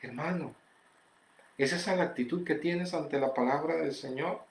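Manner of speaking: very soft, solemn questioning; hushed appeal. Real, not acted. Preacher searching the congregation's conscience, hushed. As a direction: drop right down and let it sink in.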